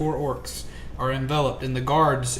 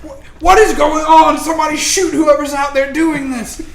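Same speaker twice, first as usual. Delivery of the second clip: guards shouting; 12 semitones higher, 10 dB louder than usual. Acted. GM voices guards on ground.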